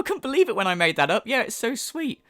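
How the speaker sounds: mock psychic. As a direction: sneer her sweetness back. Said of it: "mocking the psychic".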